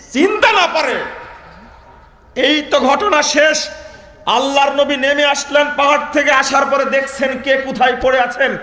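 high, forceful sermon delivery; preaching at full force. Acted. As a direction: declaim it loudly to the whole crowd. (উঁচু গলায় জোরালো ওয়াজ)

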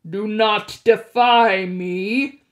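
slow, loud, looming threat; menacing god of the dead. A narrator's reading. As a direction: bear down on him like a furious god. Hades threatens Percy.

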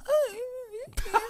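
squeaky, evasive agreement; high-pitched voice. Retold or imitated. Imitating a girl asked about his body, high-pitched. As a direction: imitate a reluctant yes way up high. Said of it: "You're using a high pitched voice".

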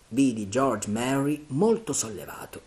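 usual speaking voice

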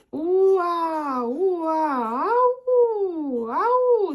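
long, swooping calls rising high; calling out. Acted. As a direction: stretch each call as if hallooing in the distance. Reading boy's calls aloud.

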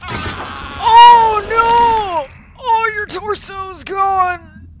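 high, loud "Oh, no!", then a slow horrified wail; shocked cry. Real, not acted. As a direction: cry out in mock horror. Shocked at the victim's torso.

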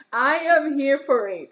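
laughing while saying it; giggling, eager and loud. Real, not acted. Laughing at the secret tease.

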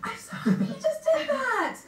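thrilled, laughing; excited. Real, not acted. Delighted host after song.

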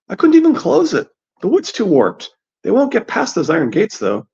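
put-on high-pitched girl's voice; high voice, matter-of-fact objection; Meera, practical.

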